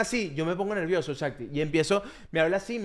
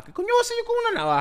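incredulous outburst; much faster than usual. Retold or imitated. Mocking the uncle's gift.

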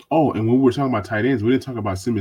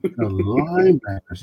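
drawn-out mock reverence; sarcastic. Real, not acted. Host repeats the group's name for his co-host, sarcastically. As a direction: stretch the word out with heavy sarcasm. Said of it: "I love your sarcasm".

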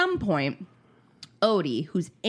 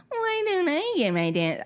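put-on high, whiny little-kid voice; 10 semitones higher than usual. Mom voicing her son who missed his dance, high and whiny. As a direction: pout it out like a left-out child. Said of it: "he's probably like".

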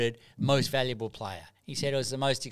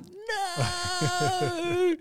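mock wail. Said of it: "And I'm like".

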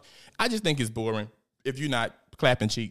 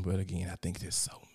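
whispered aside, softer; 6 semitones lower, 9 dB quieter than usual. Real, not acted. To viewers about cockeyed people, a whispered aside. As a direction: drop to a secret whisper. Aside she doesn't want heard.

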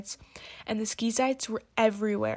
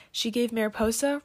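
plain reading voice

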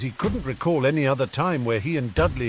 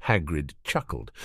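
plain reading voice

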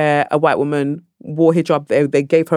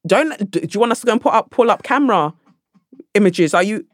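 usual speaking voice

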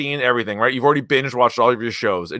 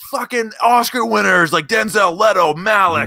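high, loud in-your-face movie trailer hype; 7 semitones higher, 3 dB louder than usual. Acted. Co-host mocking trailers that sell Oscar winners, hyped-up shouting. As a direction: shout the names like a trailer boast. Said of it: "the trailers are, like".

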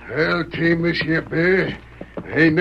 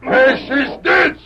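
angry command; 5 semitones higher, much slower than usual. Acted. Trainer ordering his bear to dance, angry yelled command. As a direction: force the bear to obey.

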